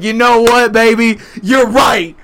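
high, loud, drawn-out wrestler roar; Stone Cold-style yell. Retold or imitated. Boyfriend agreeing with his patriotic fiancée, wrestler-style roar. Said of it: "And I'm just like".

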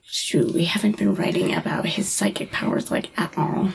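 hushed, voiceless mock confession; whispered aside. Writers admitting they forgot his psychic powers, whispered aside.

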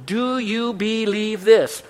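slow, drawn-out question with weight on this; slow pointed question. Shown as a demonstration. Preacher posing Jesus' question to the congregation, slow. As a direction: let "Do you believe this?" hang, slow, leaning on "this". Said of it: "And then the question".

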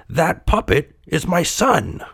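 high, slow, earnest plea; acted high-voiced entreaty; pleading. A narrator's reading. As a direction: stretch it out as a desperate appeal. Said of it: "Geppetto pleaded".

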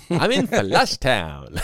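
slow, drawn-out gloating voice; mock smug poker brag; imitating Ziff. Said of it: "no poker face at all".

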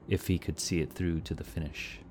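ordinary delivery, nothing marked

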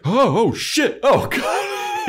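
hammed-up frightened shriek; mock panic. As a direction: yelp in terror at the demon. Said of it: "he's like".

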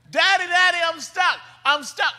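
high-pitched mock plea of a stuck boy; screaming child. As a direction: imitate a kid still calling for daddy. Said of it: "he's screaming from the backyard".

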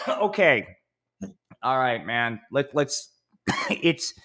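coughing between words; coughing fit. Real, not acted. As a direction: talk through a cough. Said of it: "Excuse me for coughing".

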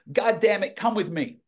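put-on high Southern-accented command; Carl Killingsworth. Southern man orders the page along, imitated Southern accent. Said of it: "that's how Carl talked".

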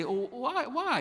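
high-pitched imitation of curious children; inquisitive kids. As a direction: ask lightly, way up high, like a child. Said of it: "they're really inquisitive".

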